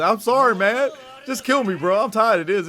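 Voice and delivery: loud, high hammed-up plea; voicing a beaten monster's desperate apology; mock apologizing devil. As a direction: beg for it to end, loud and high. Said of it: "it's apologizing".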